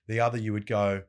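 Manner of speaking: usual speaking voice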